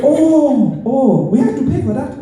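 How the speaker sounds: high, fake dawning surprise; mock surprise. Feigning surprise to the collector, mock shock. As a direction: act shocked to learn it. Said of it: "I said".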